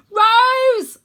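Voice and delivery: very loud, high call; shout. Acted. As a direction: yell across the pit. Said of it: "I shout".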